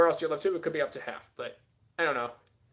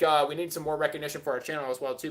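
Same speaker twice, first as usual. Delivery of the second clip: ordinary talk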